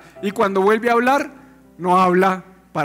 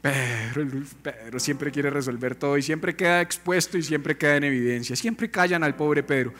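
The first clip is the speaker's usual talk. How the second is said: [name] burlón; 6 semitones lower, 5 dB quieter than usual. Acted. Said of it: "dice [name]".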